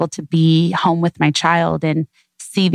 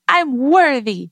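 put-on higher, slow little-kid voice; imitating toddler; 7 semitones higher, slower than usual. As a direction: draw it out like a proud toddler. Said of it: "he just will start screaming".

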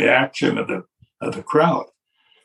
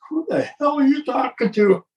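indignant disbelief; 12 semitones higher than usual. Retold or imitated. Vince's indignant look voiced.

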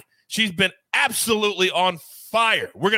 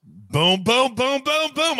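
excited; 7 semitones higher, slower than usual. Real, not acted. Celebrating a pick.

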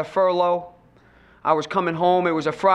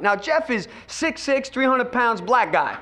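ordinary talk

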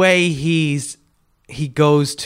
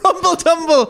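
high, loud mocking echo; laughing, pitched-up mimicry; teasing mimicry; 5 dB louder than usual. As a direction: parrot the silly phrase back. Mocking the co-host's odd phrase.

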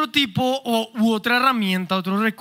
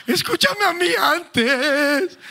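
mock exasperated plea; 5 semitones higher, much slower than usual. Retold plea during couple's fight.

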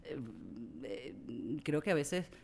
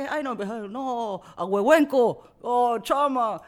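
high, loud, drawn-out Mexican mimicry; mock Mexican accent; 8 semitones higher, 9 dB louder, slower than usual. Mexicans teasing her Venezuelan words, high and loud. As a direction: tease like a Mexican friend.

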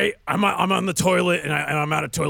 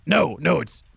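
ordinary talk

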